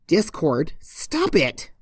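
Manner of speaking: a mild growl at the prankster. Acted. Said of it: "she growled".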